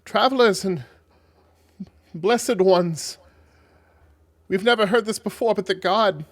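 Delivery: loud, high-pitched herald's announcement; reverent messenger. Acted. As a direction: proclaim it to honoured guests. Voices a messenger of the god.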